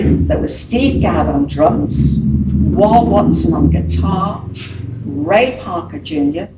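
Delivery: slow, deliberate naming; slow roll-call of the band; said slowly. Real, not acted. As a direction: spell each name out slowly. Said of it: "Say them slowly".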